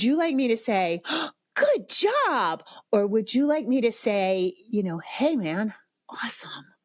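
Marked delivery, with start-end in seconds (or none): 1.1-2.6 very high, gushing fake praise; gasping, overexcited sing-song; sing-songy cheerleading. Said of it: "stop the sing-songy voice". 5.0-6.7 soft, understated approval dropping to near-whisper; casual, hushed sincerity; sincere, low-key praise. Acted. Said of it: "What sounds more sincere?".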